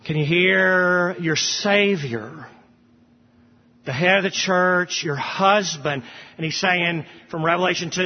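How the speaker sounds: normal talking voice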